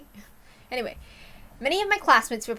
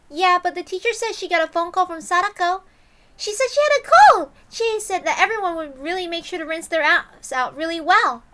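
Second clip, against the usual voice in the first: put-on high, louder girl voice; chatty schoolgirl; 9 semitones higher, 9 dB louder than usual. Girl telling the boy about Sadako's call. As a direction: pass on the news brightly.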